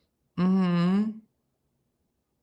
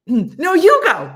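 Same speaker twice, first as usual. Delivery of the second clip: high, loud playful insistence; mock argumentative shout; 11 semitones higher, 12 dB louder, slower than usual. Trapeze partners arguing who goes first, loud mock shout. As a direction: yell it across to a balking partner. Acting out trapeze back-and-forth.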